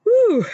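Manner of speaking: sharp high whew of released emotion; emotional exhale. Real, not acted. Host overcome after the prayer, loud emotional whew. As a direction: let out a loud overwhelmed breath. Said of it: "I'm getting emotional".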